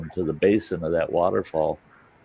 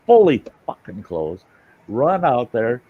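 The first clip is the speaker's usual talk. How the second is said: normal talking voice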